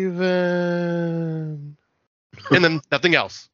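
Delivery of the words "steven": drawn-out, haunting whisper of a name from a tape; eerie ghost voice. Retold or imitated. Eerie tape voice calling his brother's name, haunting and drawn out. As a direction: call the name like a ghost in the silence.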